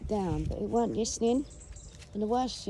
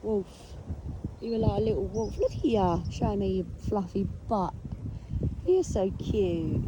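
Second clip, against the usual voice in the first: cooing to the dog. Real, not acted. Cooing to his small dog.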